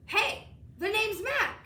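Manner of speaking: a big scruffy creature saying hello; Mac monster voice. A narrator's reading. Mac greets the boy from under the bed.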